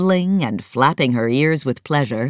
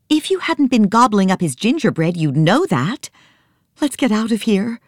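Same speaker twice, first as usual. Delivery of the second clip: exasperated; 8 semitones higher than usual. Acted. Nancy scolding sleepy Joe, mildly angry. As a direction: tell him off sharply. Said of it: "Nancy glared at him".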